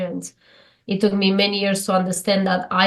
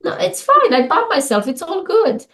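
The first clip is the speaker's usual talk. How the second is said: breezy self-reassurance; 9 semitones higher than usual. Retold or imitated. New kind reaction to bumping.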